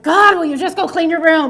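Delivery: put-on mother yelling at her fourteen-year-old to clean her room, irritated; irritated yell at daughter. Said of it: "I'm irritated. And I'm like".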